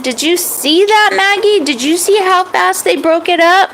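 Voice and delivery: thrilled at the ants; excited boy. Acted. Boy showing his dog the ants, excited.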